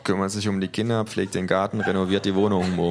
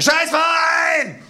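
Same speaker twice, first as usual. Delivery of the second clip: long drawn-out, very high loud shout; full scream; 9 dB louder, much slower than usual. Acted. Narrator demonstrating the strong word, screamed. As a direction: stand up and bellow it, stretched out. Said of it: "ich stehe auf und schreie".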